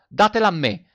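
sudden loud high cry; shout. A narrator's reading. De Rossi shouting to classmates, loud and commanding. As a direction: shout it out to seize the medal. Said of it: "De Rossi gridò".

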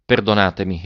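usual speaking voice

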